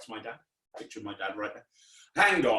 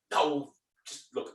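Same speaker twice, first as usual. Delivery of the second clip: usual speaking voice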